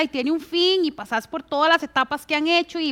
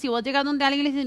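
usual speaking voice

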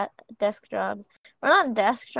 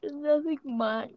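words stretched slow through a yawn; 4 semitones higher, slower than usual. Real, not acted. Answering what's going on, through a real yawn. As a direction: say it mid-yawn. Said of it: "I'm sorry, I yawned".

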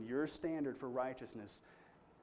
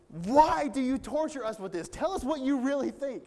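much higher, louder fed-up demand; a king losing patience, pushing for the truth; exasperated king; 10 semitones higher, 10 dB louder than usual. Retold or imitated. King demanding that Micaiah stop mocking, loud and high.

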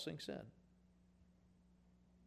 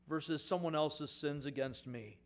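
usual speaking voice

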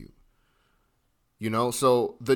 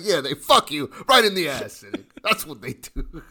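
louder, laughing through a crude paraphrase; laughing crude retelling; 4 semitones higher, 5 dB louder than usual. Retold or imitated. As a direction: crack yourself up as you blurt it out. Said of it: "I was like".

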